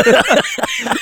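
squealing laugh; high-pitched laugh. Real, not acted. Laughing at drunk joke.

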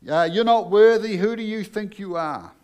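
shouted voice of the devil; devil's taunting yell. Acted. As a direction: sneer it down at them like a bully. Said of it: "The devil wants to yell and scream in your ear".

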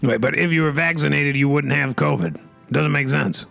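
ordinary delivery

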